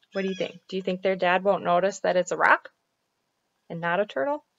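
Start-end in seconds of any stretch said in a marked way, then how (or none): none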